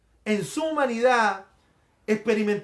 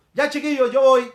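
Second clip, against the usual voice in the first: casual, higher and louder call to friends; lightly acted reassuring shout; Jesus calling out; 4 semitones higher, 7 dB louder than usual. Jesus to his disciples as he sets out, calling out.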